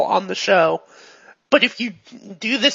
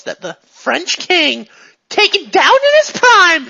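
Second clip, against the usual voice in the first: high, loud impassioned outburst; yelling; 8 semitones higher, 6 dB louder than usual. Acted. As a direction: build to a yell, pitch leaping up. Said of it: "she's yelling now".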